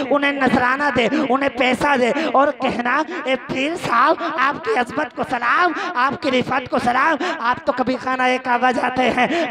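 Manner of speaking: rousing sermon tirade with stretched endings; full-force preaching. Acted. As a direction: build the sarcastic address into ringing cries. Preaches mocking instructions at full force. (पूरे जोश में तंज़ भरी तक़रीर)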